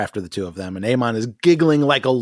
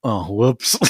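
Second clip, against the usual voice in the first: mock innocent oops; 2 semitones lower than usual. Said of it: "Aemon's like".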